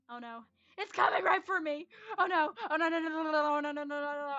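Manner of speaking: loud rising panic, repeating no; panicked oh no. Acted. Ball heading right for him, loud panicked yelling. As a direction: yell it as the ball comes at you.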